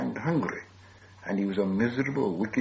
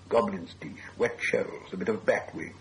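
ordinary delivery

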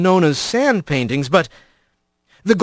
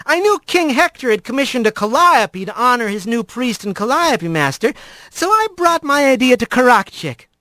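higher, friendly crowd address; otter speaker voice; 7 semitones higher than usual. A narrator's reading. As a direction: greet the crowd warmly. Fisher explains to Alphonse.

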